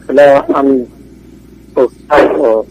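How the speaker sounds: ordinary delivery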